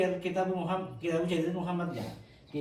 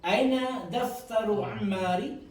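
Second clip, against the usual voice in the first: slow, raised question for the class; slow model question; 4 semitones higher, much slower than usual. Shown as a demonstration. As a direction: drag it out slowly, lift the end. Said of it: "Saya baca jenengan anu ya". (pertanyaan contoh lambat)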